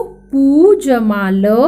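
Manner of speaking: पू जमा ल spelled out very slowly, each piece apart; slow syllable by syllable. Shown as a demonstration. Teacher slowly spells out पुल for the children. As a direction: build the word slowly, piece by piece. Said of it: "उच्चारण कैसे करते देखो".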